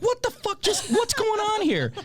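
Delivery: high, stretched-out disbelief; shocked disbelief. Retold or imitated. Robbed by a kid in the game, baffled and high. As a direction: squeak out what's going on, slow and baffled.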